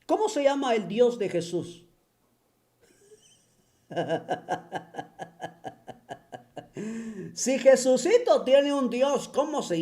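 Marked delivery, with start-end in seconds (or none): 3.9-6.3 soft, breathy scornful laugh; quiet mocking chuckle. Real, not acted. Preacher chuckling at his own question to listeners, mocking.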